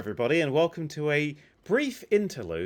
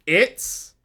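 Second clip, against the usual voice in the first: staccato; 2 semitones higher, 3 dB louder than usual. Shown as a demonstration. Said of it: "Repeat after me, one word at a time".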